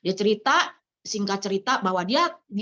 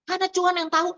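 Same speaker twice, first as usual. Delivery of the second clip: higher, rising preacher's voice; preaching; 8 semitones higher, faster than usual. Acted. Pastor urging her listeners to return to God, preaching. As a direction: lift it into a sermon climax.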